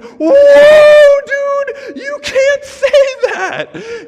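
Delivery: put-on high, loud shocked yell at a kid; mock shout. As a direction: shout it out, stunned and scolding. Scolding a cursing kid.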